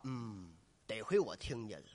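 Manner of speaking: low, soft thinking to himself; inner voice. Acted. Old actor thankful he heard it, low inner voice. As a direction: say it privately, low and quiet. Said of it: "心里话".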